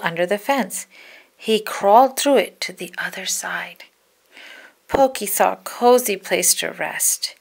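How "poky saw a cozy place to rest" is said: usual speaking voice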